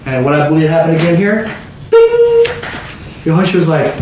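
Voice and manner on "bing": high, ringing imitated ding; bright "bing" chime. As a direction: sing out a sudden high bing. Imitates the moment sight returns.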